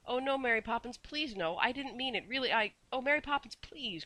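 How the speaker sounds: put-on high, urgent child's voice begging; a boy desperate not to be sent home; pleading boy. A narrator's reading. Boy begs nanny, high and pleading. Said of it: "cried Michael".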